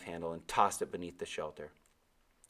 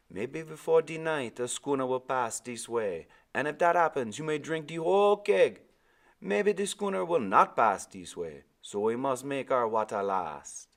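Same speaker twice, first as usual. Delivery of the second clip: put-on high, loud island-accented old man; old Caribbean sailor; 6 semitones higher, 5 dB louder than usual. Old sailor answering the boy, Caribbean accent. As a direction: give Timothy his lilting Caribbean voice. Timothy speaks.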